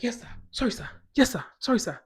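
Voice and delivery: quick, high obedient replies; imitated flustered, dutiful officer; apologetic cop. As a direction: apologise crisply to a superior.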